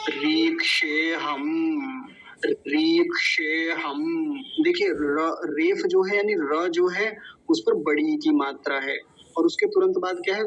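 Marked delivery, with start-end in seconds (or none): 0.1-4.4 "रीक्षेऽहम्" slow, long ree, final m hummed; careful Sanskrit pronunciation. Shown as a demonstration. Teacher models the next word for students, slow and careful. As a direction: sound out "रीक्षेऽहम्" slowly, holding each syllable. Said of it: "तो उसका बड़े ध्यान से करेंगे".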